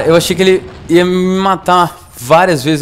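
normal talking voice

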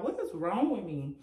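usual speaking voice

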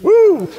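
high, loud "woo" of praise; exultant whoop. Real, not acted. Preacher overcome after Rose of Sharon praise, exultant whoop.

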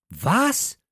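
high, loud burst of disbelief; startled exclamation; shocked outcry. A narrator's reading. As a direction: cry out in astonishment. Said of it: "riefen Steffi, Radieschen und Friedhelm wie aus einem Munde".

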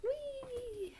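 playful whee. Real, not acted. Child riding toy.

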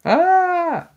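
very high sustained shriek; loud, held high squeal. A narrator's reading. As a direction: let out a piercing girlish scream. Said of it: "Jack heard Annie shriek".

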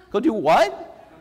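high, puzzled echo; disbelieving double take; baffled question. Retold or imitated. Ethan to the therapist, confused and high.

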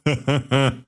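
Klepan's rough amused laugh; hearty laugh. A narrator's reading. Klepan laughs at the hero's dream. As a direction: laugh at the bearded woman dream.

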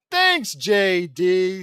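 slow, loud, drawn-out vampire voice. Acted. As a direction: stretch it out like a vampire. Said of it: "says Laurie".

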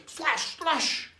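high, sharp slashing noises; two swift falling strokes, high-pitched; sword slashes. Acted. Second pirate shows off his cutlass, high swishing slashes. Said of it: "And then he goes".

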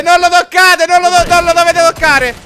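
loud frantic yelling; shouting. Real, not acted. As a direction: yell at them to back off. Streamer yelling at teammates.

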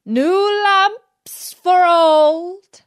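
loud, slow, high street hawker's call; crying out. Acted. Magician crying his wares outside the palace, loud drawn-out call.

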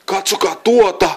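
high, excited cry; yelp. A narrator's reading. Jimmy points out the flying candy, yelping. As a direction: yelp it in amazement. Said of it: "Jimmy parahti".

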